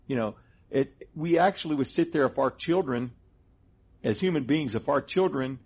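ordinary delivery

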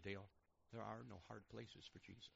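soft, hushed retelling of a divine word; simple quiet word. Retold or imitated. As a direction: say it softly and simply. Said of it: "all he said to me was a simple word".

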